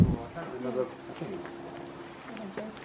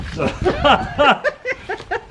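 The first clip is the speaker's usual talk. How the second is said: burst of laughter; 10 semitones higher, 5 dB louder than usual. Real, not acted. Laughs at slip on camera.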